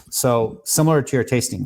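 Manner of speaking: usual speaking voice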